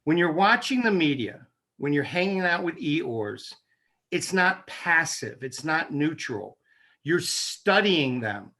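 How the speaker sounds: measured pace, each phrase set apart and landed; slow, deliberate. Shown as a demonstration. Host giving listeners something practical, slow and deliberate. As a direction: spell it out slowly for the listeners. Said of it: "try to say it slowly".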